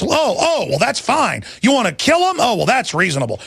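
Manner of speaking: high-pitched sarcastic acceptance; mock approval. Host mimicking people who excuse real racism, sarcastic mock approval. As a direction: play along sarcastically, pitch jumping up then dropping. Mocks a double standard.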